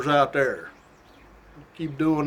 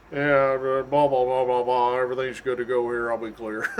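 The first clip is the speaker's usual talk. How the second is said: calm radio voice; 4 semitones lower than usual. Retold or imitated. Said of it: "don't ever wanna sound stressed on the doggone microphone".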